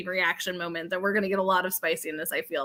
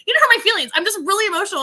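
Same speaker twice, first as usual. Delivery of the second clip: high, loud, heated protest; mock angry outburst; 10 semitones higher, 6 dB louder, faster than usual. To someone apologizing, a loud heated retort. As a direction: fire it back like you're being misread.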